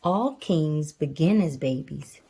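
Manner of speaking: usual speaking voice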